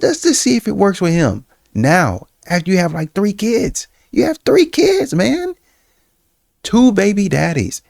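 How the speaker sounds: shrill incredulous rant with laughter; incredulous refusal. Retold or imitated. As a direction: refuse her as if she's out of her mind. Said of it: "That's what he's saying".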